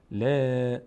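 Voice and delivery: "ل" held as a thin, front "laa"; thin lam. Shown as a demonstration. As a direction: keep "ل" light, mouth spread sideways. («ل» باریک و کشیده)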